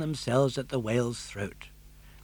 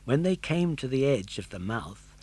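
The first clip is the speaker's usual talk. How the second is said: usual speaking voice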